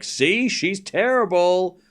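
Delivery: slow, sneering mock of a fickle fan; mocking chatter voice. Streamer imitating chat's smug verdict, slow mock. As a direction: drag it out like a smug doubter. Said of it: "you're like".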